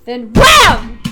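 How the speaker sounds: sudden shout; bursting shout of impact; shouted wham. A narrator's reading. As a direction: blast the word out. Sound of the elevator launching.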